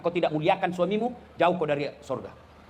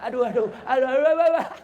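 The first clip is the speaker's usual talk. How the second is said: rising, louder mock cries of ow; acted pain, building; 4 semitones higher, 4 dB louder than usual. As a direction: play-act a bitten mother, building to a loud yelp. Mother bitten, cannot pull free. (mengaduh kesakitan, makin keras)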